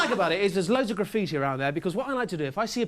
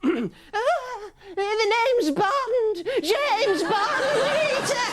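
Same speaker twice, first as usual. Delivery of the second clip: much higher, slow, squeaky Bond; a squeaky bad Bond, drawn out; Les Dennis as Connery; 3 dB louder, much slower than usual. Les Dennis mangling Connery for Rita, much higher and slow. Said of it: "Les Dennis doing Sean Connery".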